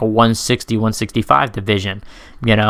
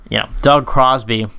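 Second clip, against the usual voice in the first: ordinary talk, nothing marked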